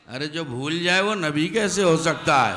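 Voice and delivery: indignant shout. Real, not acted. Rebuking the idea a prophet forgets.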